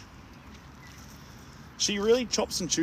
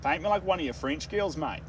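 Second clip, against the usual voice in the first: put-on everyday broad Australian accent, slightly raised; usual Aussie accent; 3 semitones higher than usual. Streamer demonstrating the usual Aussie accent to chat, female Aussie rendition. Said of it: "the usual Aussie accent that you'll hear".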